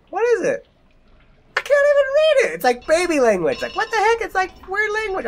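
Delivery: squeaky bewildered; high confused rambling; high baffled. Real, not acted. Baffled by strange writing.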